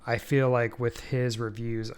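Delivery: normal talking voice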